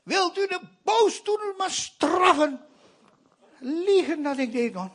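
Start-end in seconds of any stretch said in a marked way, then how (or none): 0.0-2.6 high mock-pious shouted prayer; loud and pious. Boy praying aloud in church, hammed-up pious shout. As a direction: pray out loud like a holy little boy. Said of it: "ik ging even hard en vroom".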